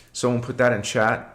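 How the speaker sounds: ordinary talk, nothing marked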